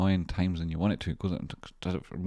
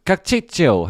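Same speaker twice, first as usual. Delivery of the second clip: high, loud shout-out; loud greeting; 12 semitones higher, 11 dB louder, slower than usual. Real, not acted. Streamer shouting a welcome to a viewer, loud. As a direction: call out a big hello.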